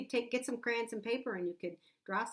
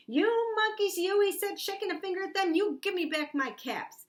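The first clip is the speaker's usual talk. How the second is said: high, loud scolding shout; raised voice, indignant demand; mad peddler; 9 semitones higher, 6 dB louder than usual. A narrator's reading. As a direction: scold them like an angry peddler. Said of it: "Ooh, he's mad".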